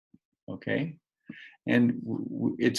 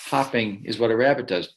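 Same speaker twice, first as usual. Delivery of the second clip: plain reading voice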